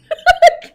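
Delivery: very high, loud burst of laughter; helpless squealing laugh; uncontrolled giggling laugh. Real, not acted. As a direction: crack up completely. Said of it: "my laugh sounds so annoying".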